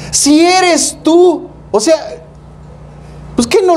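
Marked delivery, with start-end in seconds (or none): 0.1-1.5 high, slow, drawn-out doubtful Peter; doubting Peter. Retold or imitated. Peter to Jesus on the water, mock doubtful and slow. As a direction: stretch it out like a skeptic. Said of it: "le dice".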